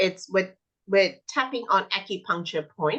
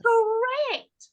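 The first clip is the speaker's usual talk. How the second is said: much higher, emphatic praise; enthusiastic praise. Shown as a demonstration. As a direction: cheer the child's right answer. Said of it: "I'm like".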